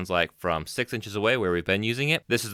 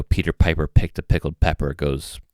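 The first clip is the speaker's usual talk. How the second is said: proximity effect; 3 semitones lower, 6 dB louder than usual. Shown as a demonstration. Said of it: "show you that the proximity effect".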